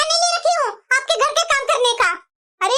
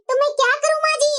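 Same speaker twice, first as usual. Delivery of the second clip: ordinary talk, nothing marked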